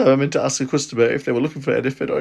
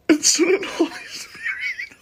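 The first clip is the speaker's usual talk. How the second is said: laughing; slower than usual. Retold or imitated. Retelling embarrassing library slip.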